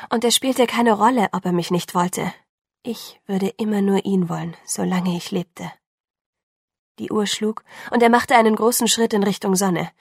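plain reading voice

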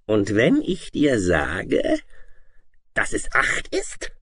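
slow, high menacing dragon voice; harsh monster testing a child; wicked dragon. A narrator's reading. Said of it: "funkelte sie böse an".

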